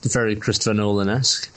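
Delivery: usual speaking voice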